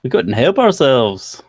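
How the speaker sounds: put-on half-hearted British accent sounding Irish; leprechaun accent. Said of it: "I sound like a leprechaun".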